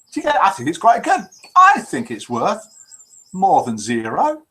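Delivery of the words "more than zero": ordinary talk, nothing marked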